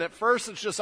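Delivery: normal talking voice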